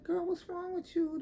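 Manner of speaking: high, put-on concerned girlfriend; friend's voice. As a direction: squeak it out like a nosy friend. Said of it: "So she was like".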